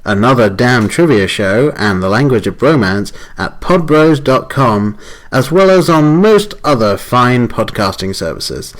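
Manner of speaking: loud upbeat promo read; announcer voice. Acted. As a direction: network announcer, bright and loud. Recorded podcast intro announcement.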